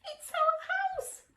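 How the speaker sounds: very high squeaky mouse voice; mother mouse squeaking to George about her house. Acted. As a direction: squeak it like a tiny upset mouse. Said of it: "squeaked the mother mouse".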